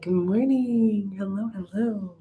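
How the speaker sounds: high, slow, stretched greeting; sing-song good morning. Real, not acted. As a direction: drawl it up high and sweet. Greeting viewers joining live.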